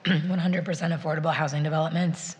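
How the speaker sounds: normal talking voice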